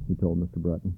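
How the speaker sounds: ordinary delivery, nothing marked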